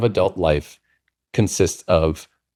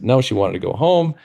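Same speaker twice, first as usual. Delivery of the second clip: ordinary talk, nothing marked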